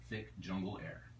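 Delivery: usual speaking voice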